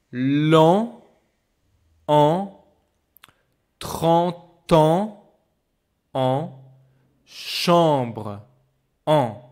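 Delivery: loud, drawn-out nasal vowel demos; teacher modelling French nasal sounds. Shown as a demonstration. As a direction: model each nasal sound for the class to copy. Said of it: "You can repeat after me".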